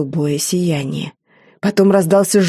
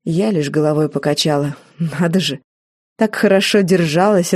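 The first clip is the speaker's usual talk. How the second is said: usual speaking voice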